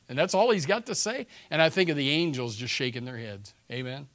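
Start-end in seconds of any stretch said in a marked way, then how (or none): 0.1-1.3 incredulous outrage. Real, not acted. Preacher outraged at chaplain's words.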